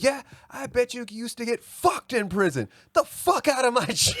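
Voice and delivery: high mock scream at the intruder; coach screaming. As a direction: throw him out of the gym with a yell. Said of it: "he's screaming this at this dude".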